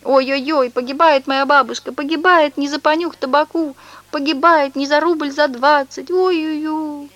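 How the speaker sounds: high, whining mock lament; fake wailing. Swindler fakes sobbing over grandma, high mock wail. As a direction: wail theatrically like a fake mourner. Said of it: "потом бросил и снова зарыдал".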